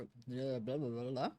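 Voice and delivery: usual speaking voice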